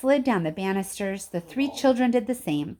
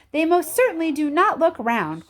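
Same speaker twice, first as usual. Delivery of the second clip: higher, louder, stubborn; indignant cry; 8 semitones higher, 5 dB louder than usual. A narrator's reading. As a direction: refuse it flatly, loud. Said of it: "cried Veruca Salt".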